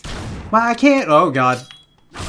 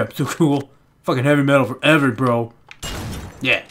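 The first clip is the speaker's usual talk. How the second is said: ordinary delivery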